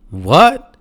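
high, rising disbelief; incredulous. Retold or imitated. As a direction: react as if you can't believe it. Retelling Miles's baffled reaction.

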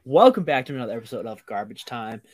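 loud showman welcome to the crowd; ringmaster. Acted. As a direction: open the show like a ringmaster. Show opening address.

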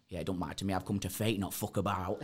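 low, offhand broad Barnsley; broad Barnsley accent. Retold or imitated. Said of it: "in this proper broad Barnsley accent".

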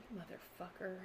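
breathed out very softly, almost no voice; a stunned, whispered curse; awed hush. Retold or imitated. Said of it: "and she went".

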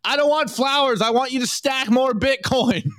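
high voiced demanding girlfriend; mock girlfriend nagging her boyfriend. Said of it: "she goes".

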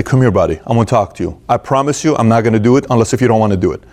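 put-on low, gentle talk to a scared child; calm your little boy down, pitched low; retold fatherly reassurance; reassuring father. Father on knees reassuring frightened son.

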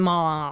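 まあ low and muffled; third tone. Shown as a demonstration. Student practising after the explanation, low まあ. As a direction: hold まあ down, no rise. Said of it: "低くくぐもって上がってこない".

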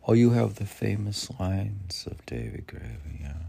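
ordinary delivery

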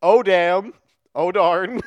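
loud, high comic relay; mock dismay. Buddy repeating Keanu's curse, loud mock dismay. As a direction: repeat the curse as a big, toned-down echo. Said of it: "his buddy's like".

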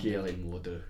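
"Galen Mordo" in a badly faked Scottish accent; hammed-up poor Scottish accent on the name; terrible accent. Shown as a demonstration. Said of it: "Uh, terrible accent".

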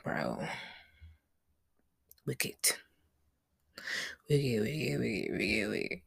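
low, fading frightened whisper; hushed, trembling chant of dread; scared whisper. Retold or imitated. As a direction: mutter it to yourself in fear. Scared in the dark elevator.

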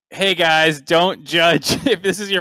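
high, loud mock plea; pleading. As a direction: imitate a caster begging viewers, half laughing. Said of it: "it was you pleading".

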